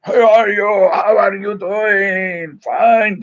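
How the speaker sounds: booming, high-pitched Italian priest's holler; big drawn-out greeting in an Italian accent; loud Italian accent. Retold or imitated. Priest hailing Jesse, in a loud Italian accent. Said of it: "had this loud voice ... great Italian accent".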